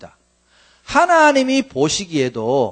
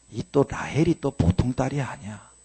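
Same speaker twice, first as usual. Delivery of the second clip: ordinary talk, nothing marked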